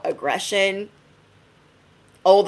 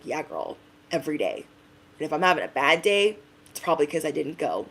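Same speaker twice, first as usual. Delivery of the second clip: put-on lower, softer young friend; friend's voice; 4 semitones lower, 7 dB quieter, faster than usual. Young Pilates friend explaining her habit, low and soft. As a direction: say it low and matter-of-fact. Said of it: "she's like".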